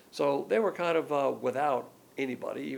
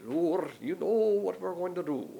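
slow, higher mock-Scottish prayer; Scottish accent; 5 semitones higher, slower than usual. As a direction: pray with a drawn-out, put-on Scottish accent. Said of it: "they have that Scottish accent".

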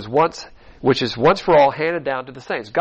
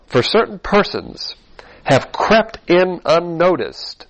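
slow, raised, emphatic verse reading; scripture reading; 4 semitones higher, 3 dB louder, slower than usual. Real, not acted. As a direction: read the verse out deliberately, word by word. Reads the verse aloud.

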